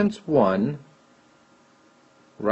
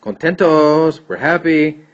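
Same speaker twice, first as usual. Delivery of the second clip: cheerful, higher and louder teacher voice; put-on happy; 5 semitones higher, 5 dB louder than usual. Teacher showing students the happy example, brightly put-on cheer. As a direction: play up being happy for the class.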